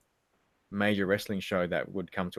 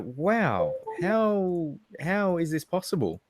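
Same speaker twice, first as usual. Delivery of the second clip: higher-pitched, amazed retelling; mock awe; 8 semitones higher, much slower than usual. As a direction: marvel in playful disbelief. Retold amazement at great service.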